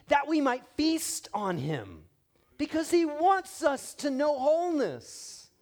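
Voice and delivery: high, loud and slow preaching; soaring preaching. Acted. Preacher urging church not to miss it, slow soaring preaching. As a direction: lift it up slowly like a climax.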